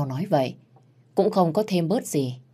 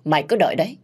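vengeful woman; 4 semitones higher than usual. Acted. Vowing to take back her honour, vengeful. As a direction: warn your enemies, rising in anger.

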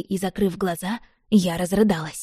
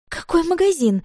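high-pitched indignant snap; exasperated outburst; 10 semitones higher, 3 dB louder than usual. A narrator's reading. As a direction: act a friend losing patience, pitch leaping up. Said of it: "не выдержала Оля". (возмущённый возглас на высоких тонах)

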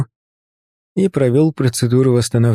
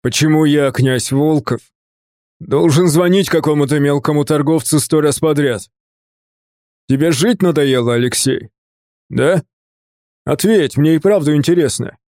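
indignant tirade, pitched up but not shouted; mildly acted fury of an affronted prince; explosive anger; 3 semitones higher than usual. A narrator's reading. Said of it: "Голос Тони сразу же взорвался, как петарда". (возмущённая тирада, ярость без крика)

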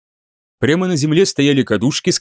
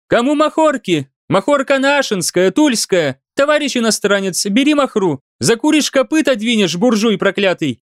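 high, brash street vendor cry; hawking peddler girl; 7 semitones higher than usual. A narrator's reading. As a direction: call out wares like a market girl.

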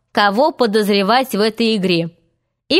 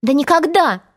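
higher-pitched emphatic exclamation; indignant cry; 6 semitones higher than usual. A narrator's reading. Girl crying out her refusal, indignant cry. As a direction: cry out in protest. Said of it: "закричала она".

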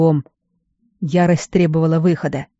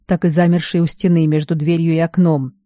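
ordinary delivery, nothing marked

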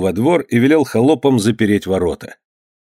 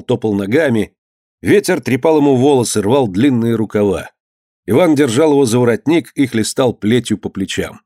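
usual speaking voice

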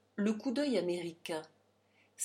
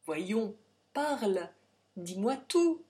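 insistant; 3 semitones higher, 6 dB louder, slower than usual. A narrator's reading. Père insiste.

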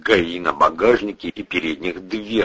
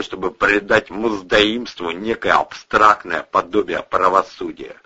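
plain reading voice

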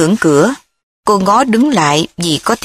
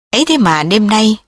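usual speaking voice

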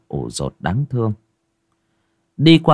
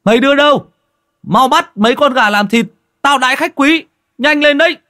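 high, loud call across the yard; calling down to the servants; 7 dB louder than usual. Acted. Ty calling to the servants, loud and bossy. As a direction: shout orders down to the workers. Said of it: "gọi với xuống dãy nhà ngang".

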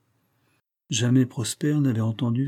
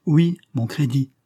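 raised, louder voice of wounded pride; indignant insistence; vibrant resentment; 5 semitones higher, 5 dB louder than usual. A narrator's reading. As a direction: push it out higher, stung by humiliation. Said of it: "rendait plus vibrante".

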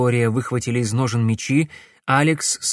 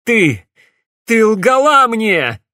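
loud, much higher wounded cry; bitter shout; 10 semitones higher, 8 dB louder, slower than usual. A narrator's reading. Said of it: "горько крикнул Алекс".

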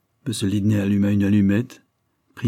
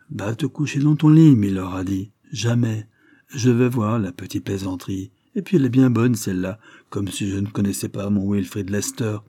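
ordinary delivery

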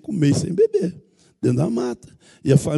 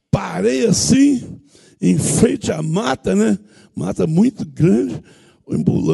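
usual speaking voice

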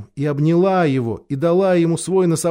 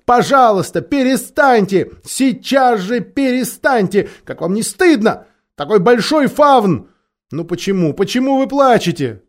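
scolding; 6 semitones higher, 3 dB louder than usual. A narrator's reading. Lucy scolding the crying Faun.

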